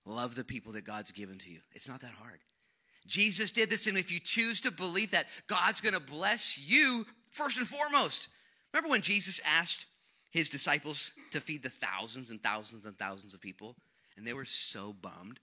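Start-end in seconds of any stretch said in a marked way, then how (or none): 3.1-11.6 full-force preaching. Acted. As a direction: proclaim the blessing to the congregation.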